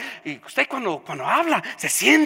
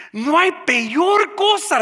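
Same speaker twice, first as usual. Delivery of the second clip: higher, louder and slow, hammering each word; preaching loud; 9 semitones higher, 8 dB louder, slower than usual. Shown as a demonstration. Pastor repeating his point to the congregation, preaching loud. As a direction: repeat it and let it ring out to the church.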